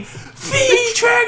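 high, drawn-out commentator scream; Kevin Kelly. Retold or imitated. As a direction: scream the move name like a wrestling announcer. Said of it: "he screams V triggers".

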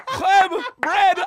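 Chleb squealed high and loud through a laugh; excited. Retold or imitated. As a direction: shout Chleb like a kid who gets it. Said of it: "I got so excited".

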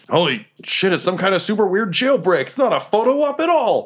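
high, climbing cry of disbelief; alarmed guards. Acted. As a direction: play a guard sounding the alarm. Acting alarmed guards to table.

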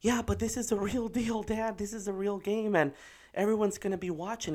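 put-on high-pitched protesting child; worried daughter. Daughter objecting to dad before real game, anxious protest. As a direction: imitate an anxious kid arguing back. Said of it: "And she says to me".

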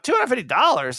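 disbelief at price. Retold or imitated. Retelling reaction to dog price.

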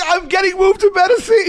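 very high gloating yell; retold scream. Dancer to booing crowd, retold gloating scream. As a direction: yell back at a chanting crowd. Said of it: "you can't scream that".

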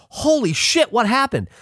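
very high, alarmed exclamation; retold shock. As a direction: cry out high in replayed astonishment. Retold shock at lightning strike.

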